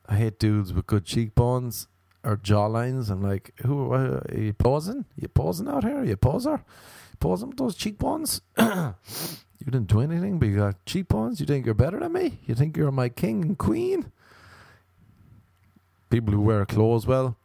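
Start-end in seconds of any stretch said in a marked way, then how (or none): 3.6-14.1 high, sneering taunt; mock the poser, pitch way up. Mocking posers.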